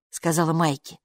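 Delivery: ordinary delivery, nothing marked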